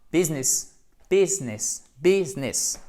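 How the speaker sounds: "business" as BIZ-ness, two syllables; repeated model of English "business"; correct English business. Shown as a demonstration. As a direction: say "business" clearly with the middle i silent. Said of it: "Et pas business".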